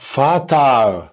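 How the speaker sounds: فطر stretched very slowly, every sound clear; slow model pronunciation. Shown as a demonstration. Teacher saying the German word for the child to repeat, slow. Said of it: "قول معايا".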